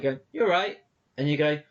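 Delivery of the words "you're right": polite passing query. Retold or imitated. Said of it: "people being polite".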